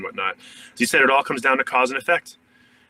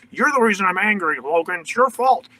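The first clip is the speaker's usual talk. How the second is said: accusing whine; 8 semitones higher than usual. Acted. Acted blaming of Logan.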